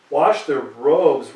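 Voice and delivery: wash their robes, dragged out slowly and loud with weight on wash; emphatic repeat. Acted. Preacher drives the point home to the congregation, slow and loud.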